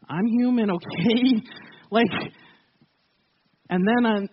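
usual speaking voice

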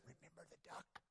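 a sly unvoiced whisper; little sister's quiet blackmail whisper. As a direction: hiss the threat secretly. Sister hisses threat at brother.

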